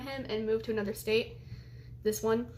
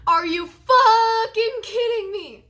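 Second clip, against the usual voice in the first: high, loud, slow furious yell; furious outburst; 10 semitones higher, 11 dB louder, slower than usual. Retold or imitated. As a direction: explode at her in disbelief. Furious outburst opening the story.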